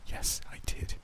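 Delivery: acted whisper, barely voiced; hushed admission. A narrator's reading. Grieving father answers his guest, in a whisper. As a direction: whisper it as a painful confession.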